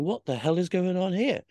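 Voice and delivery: can't believe it's happening; outraged disbelief. Retold or imitated. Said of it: "And I'm going".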